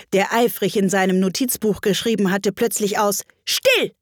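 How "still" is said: sharp high cry for silence; shout. A narrator's reading. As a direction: call out for silence. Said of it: "rief der König".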